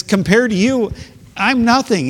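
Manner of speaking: voicing David. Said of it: "he says".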